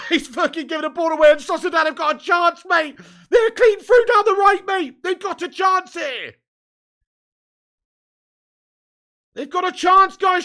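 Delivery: loud, high panicked commentary; alarmed shouting. Real, not acted. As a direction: yell in rising alarm as they break. Live reaction to counterattack.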